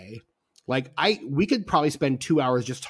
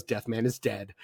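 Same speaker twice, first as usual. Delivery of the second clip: deadpan; 4 semitones lower, 7 dB quieter than usual. Retold or imitated. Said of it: "Ricky Jay just very deadpan goes".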